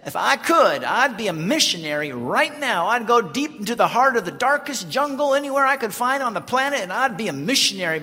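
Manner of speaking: put-on loud, high, fervent old Southern lady; imitated talkative Grandma. Grandma to the visiting pastor, imitated fervent gushing. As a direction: gush it out like a feisty granny in full flow. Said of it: "in the course of her talking".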